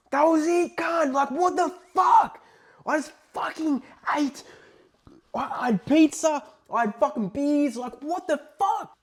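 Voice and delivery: high, loud outraged rant; outraged disbelief. Real, not acted. Telling viewers his body reacted to nothing, high and loud. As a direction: shout it out, baffled and indignant. Ranting about surprise reaction.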